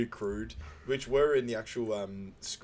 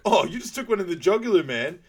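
much higher, loud, fast outburst; mock shocked exclaim; 9 semitones higher, 9 dB louder than usual. Frank reacting after the dart hits his own neck, startled outburst. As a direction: blurt it out in a startled rush.

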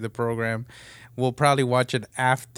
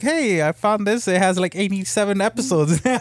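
higher, eager discovery voice; excited new listener; 8 semitones higher than usual. Retold or imitated. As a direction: sound like you've just found treasure.

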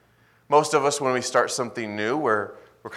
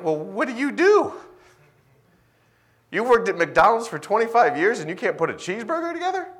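mock disbelief, higher and louder; hammed-up baffled question; incredulous; 10 semitones higher, 5 dB louder than usual. Imagined baffled customer.

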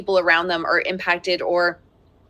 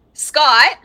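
calling out; 5 semitones higher, 7 dB louder than usual. Real, not acted. Calls husband as child cries.

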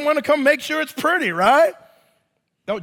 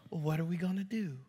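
sly wife; 5 semitones lower, 15 dB quieter, faster than usual. Retold or imitated. Wife's clever move.

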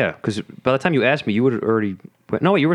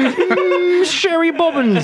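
high-pitched, giggly British nanny send-up; hammed-up British accent, much higher; mock British accent; 14 semitones higher, much slower than usual. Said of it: "I do a pretty good British accent".

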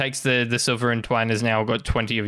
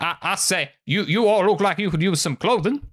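southern shopkeeper's sales patter, raised pitch; southern accent; 4 semitones higher than usual. Acted. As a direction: sell it with Southern showmanship.

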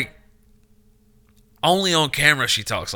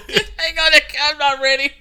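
high, breathless through laughter; can barely talk for laughing; 11 semitones higher than usual. Real, not acted. Laughing hard at the face.

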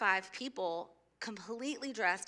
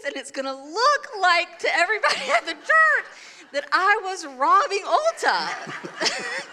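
laughing; 7 semitones higher, 7 dB louder than usual. Real, not acted. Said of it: "laughing while retelling worry".

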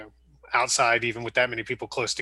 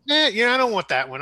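whiny recruit; 8 semitones higher, 4 dB louder than usual. Retold or imitated. Mocking a reluctant recruit.

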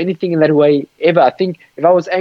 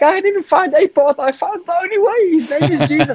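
insistent correction; 13 semitones higher than usual. Retold or imitated. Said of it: "I'm like".

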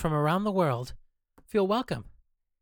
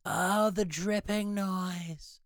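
higher, slow, drawn-out feigned understanding; mock realization; 6 semitones higher, much slower than usual. Host screwing with sound engineer, mock realization. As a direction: stretch it out, teasingly pretending it just dawned. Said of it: "I'm just screwing with you".

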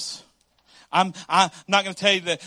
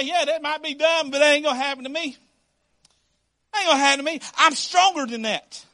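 higher-pitched, mock-confident bragging; cocky denial; 5 semitones higher than usual. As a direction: play the believer who's too sure of himself. Acts out an overconfident believer.

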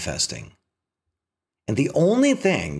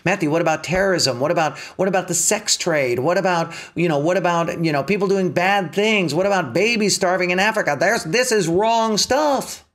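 higher, fast, pestering objections; drunk monkey voice; 7 semitones higher than usual. Pretend. The mind's drunk monkey heckling Matthew with higher, fast objections. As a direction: nag like a monkey jumping up and down. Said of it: "the drunk monkey starts jumping in there and jumping up and down and going".

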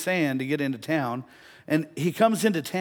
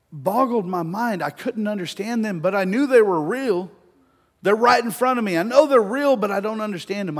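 raised, high, insistent wonder; overwhelmed amazement, louder and higher; acted amazement; 7 semitones higher, 9 dB louder than usual. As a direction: build to a loud, baffled insistence. Retells man's amazed bafflement.

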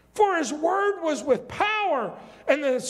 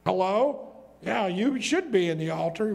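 ordinary delivery

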